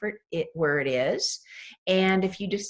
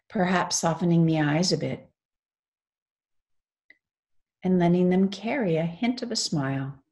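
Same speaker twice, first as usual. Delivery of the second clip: guided meditation. Real, not acted. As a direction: lead them gently into stillness, phrase by phrase.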